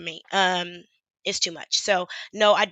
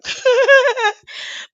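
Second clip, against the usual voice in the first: loud laugh; 8 dB louder than usual. Real, not acted. Laughing at own drunk remark.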